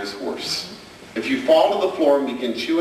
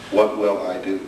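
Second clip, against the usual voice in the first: normal talking voice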